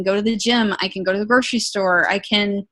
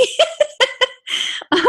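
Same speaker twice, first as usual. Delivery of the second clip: sudden high giggle; amused high-pitched laugh; high burst of laughter. Real, not acted. Laughing at friend's stunned reply.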